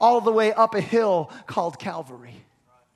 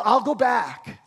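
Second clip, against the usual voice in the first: ordinary talk, nothing marked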